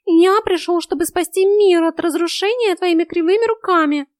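high, loud, grandiose cat voice; pompous cat. A narrator's reading. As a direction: declaim it like a self-important cat. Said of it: "с пафосом заявил кот".

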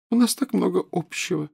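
put-on high, wistful ghost-girl voice; dreamy ghost girl. A narrator's reading. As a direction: sigh it with longing. Myrtle hopeful, dreamy.

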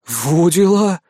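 amazed exclamation; surprised. A narrator's reading. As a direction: gasp out in surprise. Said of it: "присвистнул от неожиданности".

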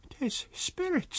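high, slow, trembling landlady voice; frightened landlady. Acted. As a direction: gasp it out, still shaken. Frightened landlady after faint.

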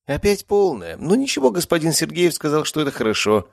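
high, cheery nursing voice; smiling nurse. A narrator's reading. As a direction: chirp it brightly to a patient. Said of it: "улыбнулся Булгаков". (высоко, с улыбкой)

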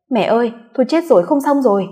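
high, loud cry for mother; alarmed yell. A narrator's reading. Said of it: "nó gào lên gọi mẹ". (gào lên hốt hoảng gọi mẹ)

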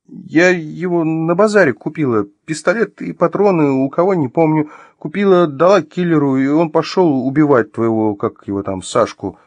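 high, halting, anxious voice; flustered confession. Acted. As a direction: improvise the story in a high, nervous voice. Flustered woman invents a confession.

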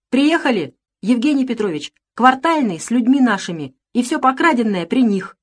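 a touch of triumphant urgency; excited announcement. A narrator's reading. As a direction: call the news in from the window. Servant announces arrivals.

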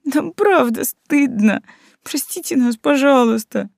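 high slow tearful lament; sobbing plea. A narrator's reading. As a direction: plead slowly through sobs. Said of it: "причитали, не переставая всхлипывать".

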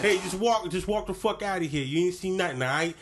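high New York tough guy; lightly voiced New York wiseguy; New York accent. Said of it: "New York accent".

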